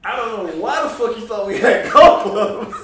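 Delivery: high, shouted disbelief breaking into laughter; loud incredulous ridicule. Retold or imitated. Friend mocking a drunken golf club threat, loud and incredulous.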